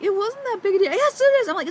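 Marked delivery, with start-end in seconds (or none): 0.0-0.9 higher, louder, shrugging it off; defensive self. Retold or imitated. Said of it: "I was like". 0.9-1.5 much higher, louder retort; emphatic retort. Retold or imitated. Husband snaps back.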